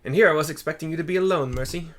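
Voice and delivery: a quick surprised greeting; Hancock voice. Acted. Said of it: "says Hancock".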